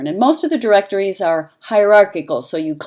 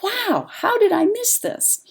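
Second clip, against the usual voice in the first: high-pitched acted-out astonishment; mock amazement to oneself; amazed self-questioning. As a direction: replay an astonished thought, pitched way up. Retells amazed thought at missed news.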